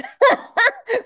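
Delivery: real burst of giggling, high; high gleeful laugh; high delighted laugh. Real, not acted. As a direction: squeal with laughter.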